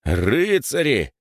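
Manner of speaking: drawn-out, high angry exclamation; cursing. Acted. Rower curses after hitting the rocks, angry. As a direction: curse them, stretching the word out. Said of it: "выругался Егор".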